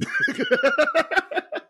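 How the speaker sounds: shrieking laugh; high squealing laugh. Real, not acted.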